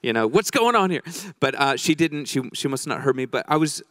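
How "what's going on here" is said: loud, higher-pitched demanding outburst; aggressive Italian mother. Retold or imitated. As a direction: imitate a mom ready to kick the door down. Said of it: "Italian mothers are a bit aggressive".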